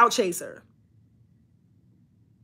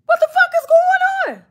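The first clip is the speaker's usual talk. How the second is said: exasperated yell. Retold or imitated. Boss walking in on her out-of-control team, high yell. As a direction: explode at the chaos.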